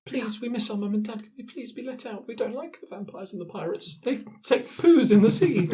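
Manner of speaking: put-on small child voice, high and hushed; scared merfolk children. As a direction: whisper it like a frightened kid in a cell.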